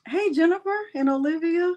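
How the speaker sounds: normal talking voice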